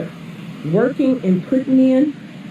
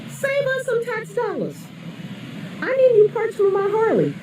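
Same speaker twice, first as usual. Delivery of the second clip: mock indifferent taxpayer; 11 semitones higher, 4 dB quieter than usual.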